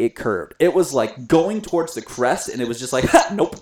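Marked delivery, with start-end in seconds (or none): 3.1-3.6 cheeky puck. Pretend. Puck voiced dodging the glove.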